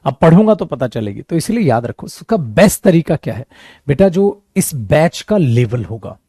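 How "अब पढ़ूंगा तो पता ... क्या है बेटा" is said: ordinary talk, nothing marked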